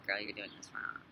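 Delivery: a hint of a disapproving critic; mock scolding voice. Mock scolding God would never say.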